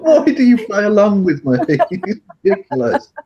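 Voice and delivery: high-pitched, helplessly amused protest; cracking up while talking; laughing in disbelief. Real, not acted. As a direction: scold through real laughter. Cracks up at failed accent.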